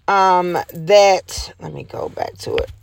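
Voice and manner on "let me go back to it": unvoiced aside under her breath; whispering to herself. Real, not acted. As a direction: whisper it while looking. Muttering while searching the page.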